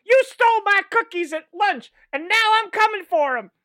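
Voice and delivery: put-on high, loud kid's trash talk; wrestling promo. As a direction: cut a tiny kid's promo. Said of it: "the Pee Wee division of pro wrestling".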